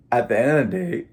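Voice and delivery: fighting back tears; choked up. Real, not acted. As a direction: voice catching with emotion. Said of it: "I'm choking up over here".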